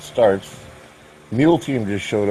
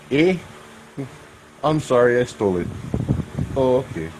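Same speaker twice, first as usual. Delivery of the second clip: put-on crappy Canadian accent; 4 semitones higher than usual. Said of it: "That's a crappy Canadian accent".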